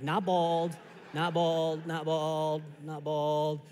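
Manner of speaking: low, level drone on each repeat; monotone scanning. Acted. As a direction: tick them off one by one in a low monotone. Scanning faces for his bald dad.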